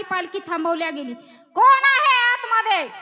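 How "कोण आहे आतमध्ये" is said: loud commanding challenge, higher; guard's shout. Acted. Siddi's guards stopping the palanquin, shouting. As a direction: bark it out like a soldier at a checkpoint.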